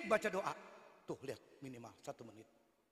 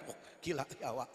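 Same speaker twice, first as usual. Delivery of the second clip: usual speaking voice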